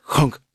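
short spoken door-shut noise; imitated door clunk. Dad imitates his son shutting the car door, clunk.

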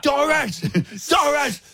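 high-pitched urgent call to Doris; panicked high call to an old lady. Retold or imitated. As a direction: call out high to stop her moving. Shouting at Doris to stop.

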